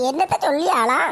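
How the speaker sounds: ordinary delivery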